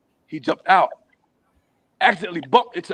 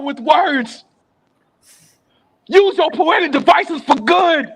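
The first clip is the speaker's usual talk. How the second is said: pleading peacemaker; 8 semitones higher, 4 dB louder than usual. Retold or imitated.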